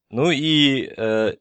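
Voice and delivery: usual speaking voice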